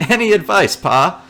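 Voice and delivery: loud eager question. Pretend. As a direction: raise the voice and pitch on it. Repeated louder, higher, with laughing.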